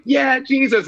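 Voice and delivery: high, thrilled shout of praise; excited cheer. Retold or imitated. College students cheering to the leader, excited. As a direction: cheer it like an eager kid. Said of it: "they were so excited".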